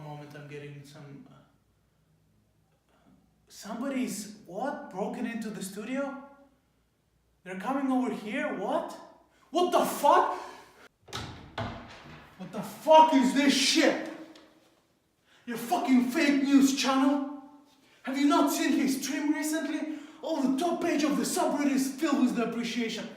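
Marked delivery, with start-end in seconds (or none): 3.5-9.0 higher, startled questions, rising panic; on-air anchor caught off guard by intruders, alarmed; alarmed surprise. Acted. Anchor hears of studio break-in. 9.5-23.1 much higher and louder, raging threats; furious intruder. Acted. Intruder storms studio, threatens anchor.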